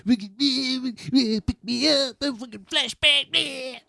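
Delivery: put-on high, slurred drunk-friend voice; drunk friend impression. Drunk friend calling for a pickup, mocked high and slurry. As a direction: squeak it like a wasted buddy on the phone.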